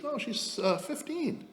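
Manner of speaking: high-pitched mock woman's voice; imitated woman. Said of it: "She goes".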